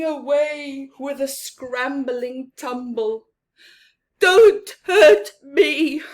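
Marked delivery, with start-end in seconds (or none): 4.2-6.0 louder, higher, slow frightened plea; hammed-up cowering plea; whimpered. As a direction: beg like a scared lion, voice cracking up. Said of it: "he whimpered".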